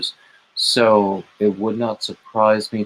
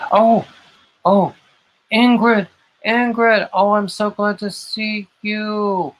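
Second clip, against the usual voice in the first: high-pitched, thrilled squeals of recognition; light up at seeing a dear friend; delighted greeting; 11 semitones higher than usual. Real, not acted. Host greeting a friend who just joined, high and delighted.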